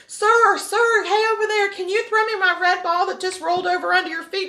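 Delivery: put-on high-pitched child calling out; little kid calling. Little kid asks a stranger for his red ball, high child's call.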